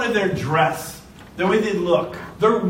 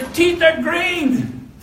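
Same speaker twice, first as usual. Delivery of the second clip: mocking kid; 8 semitones higher, much slower than usual. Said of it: "he said".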